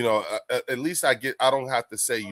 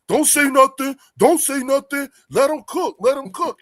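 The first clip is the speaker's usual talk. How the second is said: high, loud excited hype; excited urging; 14 semitones higher, 6 dB louder than usual. Acted. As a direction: urge them on in a high-pitched, raised voice. Acting out an excited fan's reaction.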